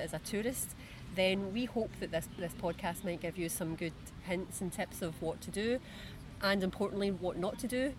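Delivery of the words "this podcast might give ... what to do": usual speaking voice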